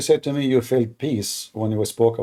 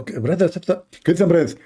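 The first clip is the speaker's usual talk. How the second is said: ordinary talk, nothing marked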